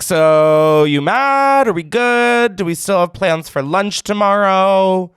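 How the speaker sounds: high-pitched sheepish feeler, hammed-up; mock tentative probing. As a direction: play someone testing whether they're forgiven. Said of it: "a temperature feel".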